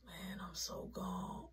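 breathy whisper, slow. Retold or imitated. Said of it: "I'm like".

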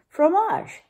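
high French poodle question. Acted. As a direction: pipe it up like a fancy poodle.

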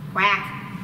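low, short mock quack; duck quack. Proving there are duck sounds, a duck quack.

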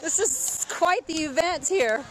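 ordinary delivery

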